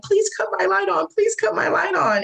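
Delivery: high, pleading cry through the door; desperate plea. Retold or imitated. Girl in her cell begging the guard through the door, high desperate plea. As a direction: beg the guard to keep the light on.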